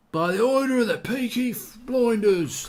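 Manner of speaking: high, loud Brummie gangster impression, deliberately overdone; bad Birmingham accent. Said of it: "That was a terrible accent".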